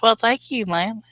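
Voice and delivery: high mock genteel thanks; put-on high voice. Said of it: "I don't know why I'm talking like that".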